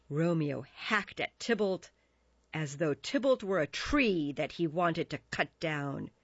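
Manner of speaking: plain reading voice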